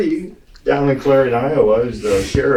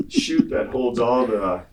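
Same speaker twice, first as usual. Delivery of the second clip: ordinary delivery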